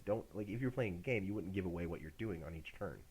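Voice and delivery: usual speaking voice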